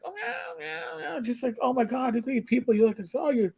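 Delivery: high soft meowing cat, cooing at the people; calico cat's voice. Retold or imitated. Stray cat greeting the family in a high, soft coo. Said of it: "she's like".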